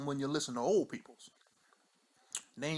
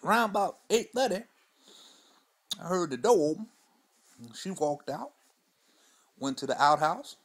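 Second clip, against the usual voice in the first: put-on old Mudbone; 3 semitones higher, 4 dB louder than usual. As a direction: spin the yarn like a weathered old man on the porch. Old storyteller character throughout.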